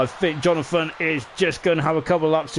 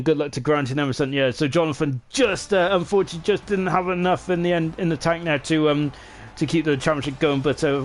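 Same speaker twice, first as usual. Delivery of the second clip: usual speaking voice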